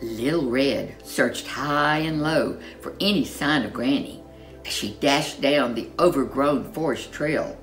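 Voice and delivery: plain reading voice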